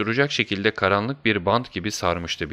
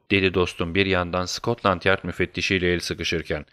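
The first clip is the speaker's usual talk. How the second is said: ordinary delivery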